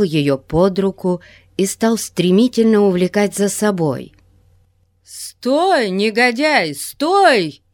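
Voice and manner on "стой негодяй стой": shouting. Acted. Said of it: "Раздался голос её брата".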